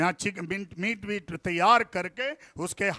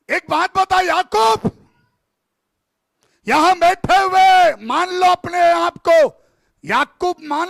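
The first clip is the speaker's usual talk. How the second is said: loud, high preaching, calling out to Jacob; preacher confronting Jacob; fiery preaching; 7 semitones higher, 10 dB louder than usual. Acted. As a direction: thunder it from the pulpit. Preaching at full force.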